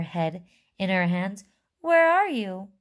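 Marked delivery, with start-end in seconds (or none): 1.8-2.7 distraught scream. A narrator's reading. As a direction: wail it up high, searching. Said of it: "screamed Missus Teave".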